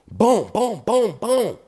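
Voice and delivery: high, loud rapid booms; imitated gunshots. Storyteller mimics shots coming at him, loud gunfire booms. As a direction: punch out each boom like a shot.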